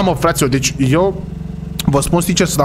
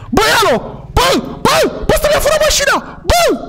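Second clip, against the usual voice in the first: high-pitched outraged yelling after a thief; panicked, very high cries of disbelief; frantic shouting. Acted. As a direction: call out in alarm across the street. Car stolen, calling after the thief.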